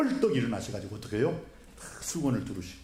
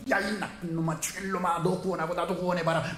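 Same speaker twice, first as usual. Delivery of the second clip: a hint of a scoffing thief, higher; mildly voiced mocking criminal; jeering thief; 5 semitones higher than usual. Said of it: "막 이렇게 욕하는 사람". (예수님을 욕하는 강도를 살짝 흉내 낸 조롱)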